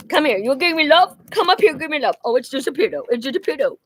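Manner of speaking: playful, high-pitched talk to an animal; pet talk. Real, not acted. Talking to the pet Raven.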